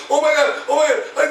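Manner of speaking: mock panicked wife, high and speeding up; high-pitched urgency, re-enacted; freaking out. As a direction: imitate frantic pleading from the passenger seat. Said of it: "she's freaking out".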